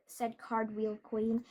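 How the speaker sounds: ordinary delivery, nothing marked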